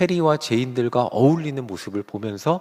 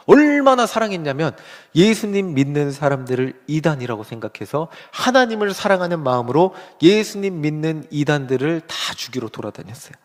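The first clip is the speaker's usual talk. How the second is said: ordinary delivery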